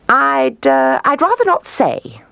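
high, hesitant emu voice; coy and reluctant, in a high voice; evasive emu. A narrator's reading. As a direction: dodge the question, pitched well up. Emu evades the owl's question.

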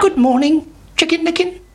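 very high storybook hen; Henny Penny. A narrator's reading. Hen greets the chick on the way, high-voiced. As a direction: chirp a rising, friendly greeting. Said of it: "said Henny Penny".

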